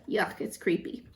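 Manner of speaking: ordinary talk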